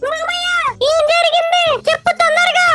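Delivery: high cow voice, urging him on; a worried cow pressing for an answer; anxious cow. Acted. Cow begging the farmer for news, high anxious voice.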